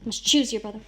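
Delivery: ordinary talk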